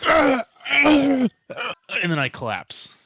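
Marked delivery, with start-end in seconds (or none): 0.1-1.3 high strained groans before collapsing; pained groans. Acted. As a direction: groan like you're about to drop. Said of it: "and I'm like".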